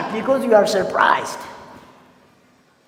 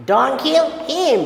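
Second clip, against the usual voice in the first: slow, higher Don't kill him with kill stressed; emphatic; 6 semitones higher than usual. Shown as a demonstration. Teacher stressing the meaning of the sentence for students. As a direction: press hard on kill. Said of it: "This means don't kill him".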